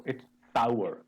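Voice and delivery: sour as sa plus a-u-r, gliding to a schwa; correct sour. Shown as a demonstration. Teacher correcting the student's sour. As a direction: model sour for the class. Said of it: "It's not sour".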